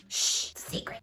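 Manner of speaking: whispered secret; shush, then whisper conspiratorially; playful, voiceless hush-hush whisper; mock hush. Hushing the viewers about a secret.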